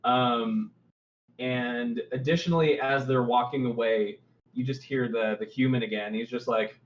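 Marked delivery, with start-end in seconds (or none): none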